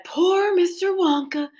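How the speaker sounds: lightly voiced sorry townspeople; townsfolk lamenting. A narrator's reading. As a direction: sigh over poor Mister Wonka. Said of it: "everybody said".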